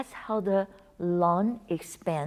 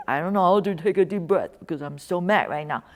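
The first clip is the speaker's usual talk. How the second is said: a touch of mock anger, a bit louder; mock frustrated retort; 5 dB louder than usual. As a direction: play the fed-up person who can't calm down. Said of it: "But I say".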